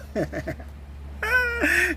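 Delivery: high-pitched laugh at the memory. Real, not acted. Laughing at own yelp.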